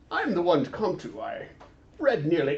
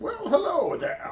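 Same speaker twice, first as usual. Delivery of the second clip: put-on old sage voice; 12 semitones higher than usual. Greeting in character voice.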